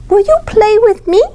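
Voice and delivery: put-on high, hopeful little-girl plea; girl pleading with the grasshopper, high and hopeful; little girl asking. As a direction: ask it like a small girl wanting a friend. Girl asks grasshopper to play.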